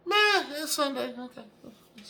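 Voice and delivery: high, squeaky mock indifference; unexcited fan. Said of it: "you're like".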